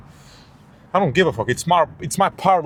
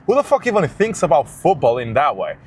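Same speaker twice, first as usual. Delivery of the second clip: ordinary talk, nothing marked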